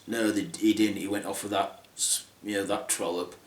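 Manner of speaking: soft, low groggy mumble; hungover mumble. Acted. Hungover stepsister mumbling softly and low. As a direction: mumble it through a hangover.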